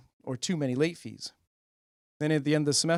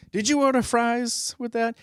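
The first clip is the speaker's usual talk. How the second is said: high, slow fast-food cashier voice; mock cashier; 9 semitones higher, slower than usual. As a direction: ask it like a drive-through clerk. Cashier parody, high pitched.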